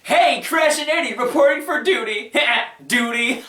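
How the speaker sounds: mock-cheery imitation with a giggle; mock cartoon goofball duo. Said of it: "where they're like".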